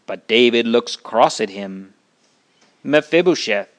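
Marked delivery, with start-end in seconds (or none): none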